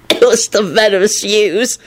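snickering. A narrator's reading. Lupin explaining from the floor, mock snickering. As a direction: squeeze it out as if suppressing laughter. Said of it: "he snickered".

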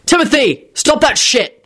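high, contained shout. A narrator's reading. Quentin ordering Timothy to stop, shouting. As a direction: raise the pitch for a scolding shout. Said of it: "shouted Quentin".

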